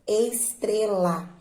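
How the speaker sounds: slow, drawn-out "estrela", each syllable separate. Shown as a demonstration. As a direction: sound out "estrela" slowly for pupils. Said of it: "Qual é a sílaba mais forte".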